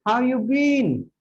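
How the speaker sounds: high, drawn-out friendly question; amazed greeting. Shown as a demonstration. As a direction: stretch it out high, thrilled to see a friend. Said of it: "You can't sound like this".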